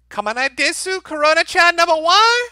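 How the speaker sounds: very high, loud mock anime squeal; Desu Desu voice. Streamer obliging a viewer's request, shrill hammed-up Desu Desu voice. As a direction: belt it out in an over-the-top, high-pitched anime voice. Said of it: "say in your most Desu Desu voice".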